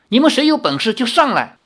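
high, loud, fast dare; calling out challenge. Acted. Girl daring the crowd below, high and loud. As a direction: call the crowd up to fight.

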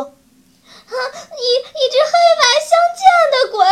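very high, loud, hurried sobbing cry; tearful wailing. A narrator's reading. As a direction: stammer it out through hysterical tears. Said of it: "娇娇哭着说".

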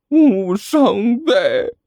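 high, very slow whine; whimpering. Acted. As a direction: moan it out pitifully. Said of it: "哼哼唧唧地说道". (哼哼唧唧地嘟囔)

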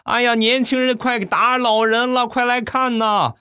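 hammed-up high-pitched wail; wailing loudly. Old man calling onlookers to witness a beating, theatrical wailing. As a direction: draw it out like a mock sobbing victim. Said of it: "嚎啕大哭".

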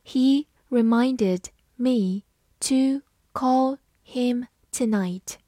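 slow, deliberate read. Shown as a demonstration. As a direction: read it slowly word by word. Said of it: "好，我们慢慢来读。".

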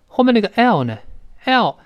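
ordinary talk, nothing marked

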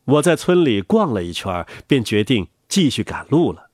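ordinary delivery

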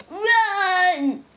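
loud, high shout to flee; panicked cry. A narrator's reading. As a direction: yell at the others to run. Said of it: "cried another".

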